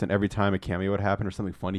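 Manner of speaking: normal talking voice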